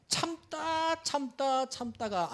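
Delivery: high, drawn-out words; held long and high; straining patience. Retold or imitated. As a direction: stretch it out like patience wearing thin. Acting out enduring until breaking point.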